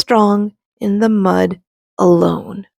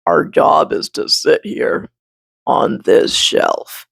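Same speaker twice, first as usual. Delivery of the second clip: breathy unvoiced bark voice; half-whispered, matter-of-fact; the old bark whispering the rules; dry whispery bark; 4 semitones lower than usual. A narrator's reading. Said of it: "says the bark".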